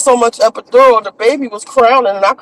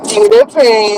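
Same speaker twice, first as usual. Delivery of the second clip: normal talking voice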